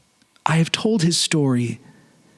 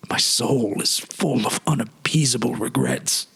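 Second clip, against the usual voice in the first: lower, hushed and heavy, a man worn down by regret; lower, weary voice of a haunted statesman; grieving friend confessing; 5 semitones lower than usual. Acted. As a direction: confide your loss in a low, haunted voice.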